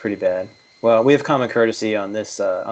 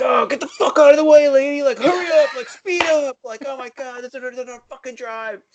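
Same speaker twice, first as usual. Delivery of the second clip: road rage yell; 13 semitones higher than usual. Retold or imitated. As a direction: screech at the lady in front to hurry up. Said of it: "and you're like".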